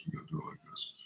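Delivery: trailing off into a whisper; fading whisper. Retold or imitated. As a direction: whisper the last repeat as it dies away. Last repeat fades to a whisper.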